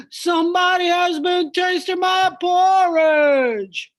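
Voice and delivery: big bear grumbling about his porridge; great big bear voice. Acted. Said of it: "in his great big voice".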